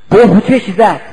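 ordinary delivery

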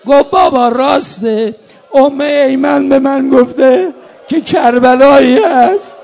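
loud, high, wailing preaching; full-force mourning lament. Acted. Preacher mourns Zeinab brought captive into Ali's city, loud wailing lament. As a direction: cry out the tragedy to a grieving congregation.

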